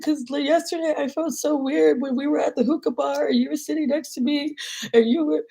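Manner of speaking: high, whiny, plaintive retelling; upset confession. Retold or imitated. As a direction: imitate your upset self pouring it out to a friend. Retelling tearful confession to friend.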